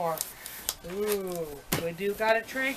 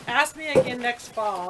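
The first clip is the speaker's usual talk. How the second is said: ordinary talk, nothing marked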